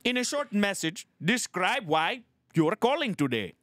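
put-on high-pitched Indian-accented automated prompt; robot phone agent. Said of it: "A robot".

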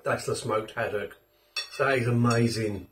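chewing while talking; muffled chewy speech; mouth partly full. Real, not acted. Seen 3: Bite taken at -9, lips pursed and chewing between words.

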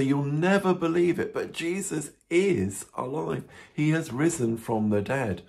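lightly acted excited announcement, starting high; excited news. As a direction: break astonishing news to a friend, stretching the key words. Disciples tell Thomas amazing news.

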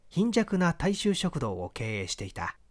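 plain reading voice

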